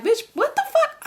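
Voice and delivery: sudden high-pitched disbelief; shocked outrage. Retold or imitated. To the guy at his door, shrieking in disbelief. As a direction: squeal it like you can't believe what you're hearing. Her shocked reaction to him.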